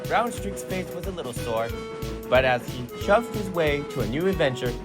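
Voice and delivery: ordinary delivery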